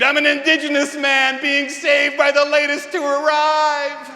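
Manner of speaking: high, loud drugged scream of gratitude. Retold or imitated. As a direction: scream it out, blissed on the drug. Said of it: "I screamed".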